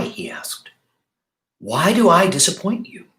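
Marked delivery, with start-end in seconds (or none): 1.6-3.1 lightly voiced pleading rabbit. Rabbit pleads with old doll.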